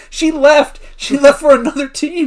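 high, loud acted protest; upset outburst. Coach about the wife who left, upset outburst.

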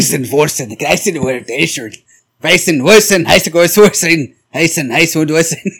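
mock German gibberish accent. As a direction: rattle off fake German words. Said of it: "your German accent".

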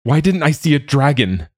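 high, loud anguished scream. Acted. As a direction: keep screaming the question in despair. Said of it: "he screamed".

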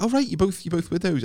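high, awkward backpedal; a hammed-up flustered reply; mock awkward. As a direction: fumble it out like you're digging a hole. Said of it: "I was digging a hole for myself".

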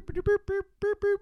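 high quick boops; mock beeping. Narrator adding a comic arrival cue, high boops.

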